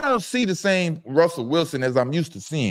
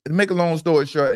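ordinary talk, nothing marked

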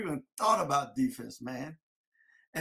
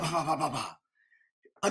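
slow, thudding bah noises; hitting sounds; 3 semitones lower, slower than usual. Retold or imitated. Player acting out tacklers slamming him, slow bah thuds. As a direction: mimic each hit landing, one by one. Imitating hits landing on him.

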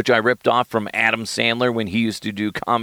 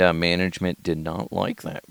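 normal talking voice

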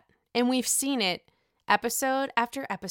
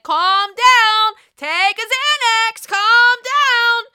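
much higher, louder sing-song Ramona; Ramona impression; 12 semitones higher, 10 dB louder, much slower than usual. Quoting Ramona to an angry husband, sing-song. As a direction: belt it like a shrill reality star. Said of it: "As Ramona said".

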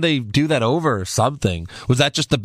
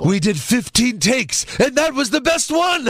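very high-pitched cartoon old tycoon voice; high, exclaiming Simpsons impression; Mister Burns; 11 semitones higher than usual. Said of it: "Sound like Mister Burns".

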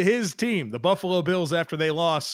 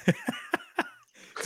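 laughing; 8 dB quieter than usual. Real, not acted. Laughs before naming a movie.

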